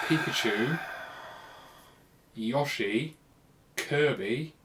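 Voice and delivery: usual speaking voice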